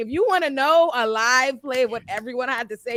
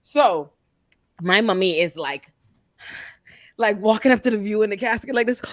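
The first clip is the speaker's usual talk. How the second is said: ordinary talk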